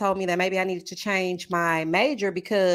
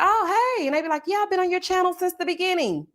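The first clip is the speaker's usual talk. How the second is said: high-pitched mock follower greeting; imitating followers; 9 semitones higher than usual. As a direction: put on a higher voice for the followers. Voicing chatty followers' greetings.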